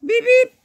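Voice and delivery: high, loud beep beep; horn beeps. A narrator's reading. Reading about race cars, high loud horn beeps. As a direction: honk like a little car horn.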